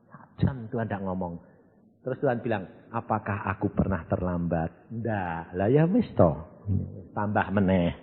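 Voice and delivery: hushed retelling. Retold or imitated. Quiet retold exchange with God.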